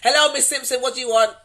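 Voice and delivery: loud, high brisk greeting; mock brisk greeting. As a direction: snap the greeting. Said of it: "I'll phone you and be like".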